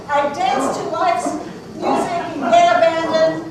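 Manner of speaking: loud, grand showman tiger; boastful tiger. Acted. Tiger boasting of itself to the audience, loud and grand. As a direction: proclaim yourself like a circus star.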